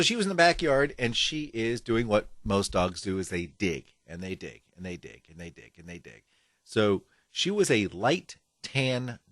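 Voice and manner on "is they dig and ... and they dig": fading away. Acted.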